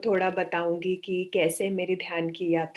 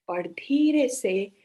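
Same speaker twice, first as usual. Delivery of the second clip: slow meditation guide, long pauses between phrases; guided meditation. Acted. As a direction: guide the breathing slowly, drawing out the words.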